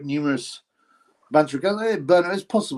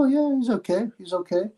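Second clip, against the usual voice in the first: higher-pitched conceding voice; imagined lenient verdict; 7 semitones higher than usual. Retold or imitated. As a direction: concede it in a raised, imagined spectator's voice. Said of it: "you'd go".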